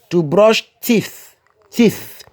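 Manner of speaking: "To brush teeth" drawn out very slowly; slow teaching demo. Shown as a demonstration. Teacher models the phrase slowly for beginners. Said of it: "pour prononcer ça".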